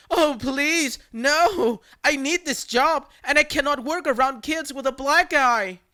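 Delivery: high, whiny fake sobbing plea; hammed-up fake crying to the caller. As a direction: ham up a begging victim. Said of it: "still fake crying".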